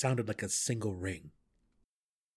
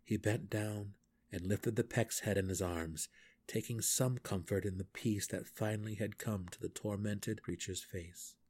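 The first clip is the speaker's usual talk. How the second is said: usual speaking voice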